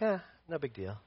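mock indifferent shrug. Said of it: "something, he's like".

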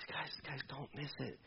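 hushed plea. Real, not acted. Urgent hushed plea to congregation.

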